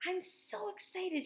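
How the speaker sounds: put-on high, thrilled girl's voice; excited. Ruth Rose telling the boys on the bus, excited and high. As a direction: squeal it with delight. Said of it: "she said".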